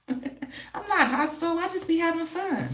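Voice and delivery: high, laughing through the words; giggly high voice, brushing it off. Real, not acted. Laughing off a comment.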